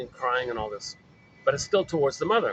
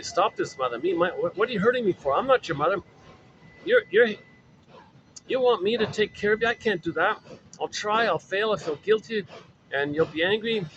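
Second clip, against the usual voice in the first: put-on a distressed child voice, higher throughout; higher, exasperated child talking back; protesting baby; 5 semitones higher than usual. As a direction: push back at mother, upset and pleading. Baby protests to shaming mother.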